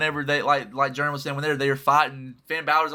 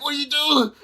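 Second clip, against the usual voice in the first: high, shocked cry; hammed-up betrayed brother; mock shocked; 11 semitones higher, 5 dB louder than usual. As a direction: react to a betrayal in disbelief. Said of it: "Jimmy's like".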